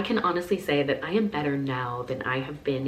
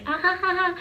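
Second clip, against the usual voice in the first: high airhead giggle; mock ditzy laugh; 3 dB louder than usual. Mocking the ditzy girl people think she is, high giggle. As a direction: play the dumb internet girl laughing. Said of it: "dumb girl on the internet who's just like".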